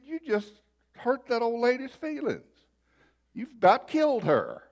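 imitated wifely scolding, pitched high; mock reproach in a high voice. Wife scolds him in retold scene.

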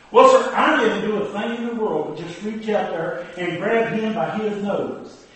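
ordinary talk, nothing marked

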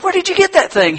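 high, eager wondering voice; eager curiosity. Acted. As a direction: ask like you've never seen anything like it. Buyer marvels at watch.

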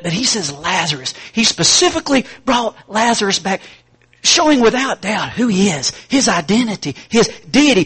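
high, loud, ringing pulpit voice; full-force preaching. Acted. Preacher thundering to the congregation about the graveyard. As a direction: preach it out to the back row.

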